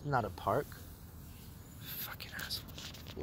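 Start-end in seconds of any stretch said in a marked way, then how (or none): 1.8-3.2 whispered curse under the breath; a muttered insult, barely voiced. Real, not acted. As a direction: hiss it so they can't hear. Muttered insult at noisy people.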